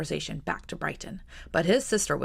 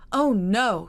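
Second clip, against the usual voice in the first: louder dismayed outcry; cried out; 5 semitones higher, 7 dB louder than usual. Acted. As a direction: exclaim in alarm. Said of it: "she cried".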